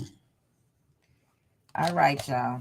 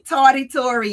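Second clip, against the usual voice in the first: teasing welcome; 6 semitones higher, 7 dB louder than usual. Real, not acted. Greeting a late viewer.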